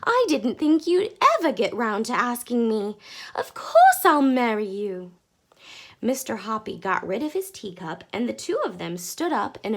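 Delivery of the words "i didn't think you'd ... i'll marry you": happy acceptance. A narrator's reading. Accepting a marriage proposal.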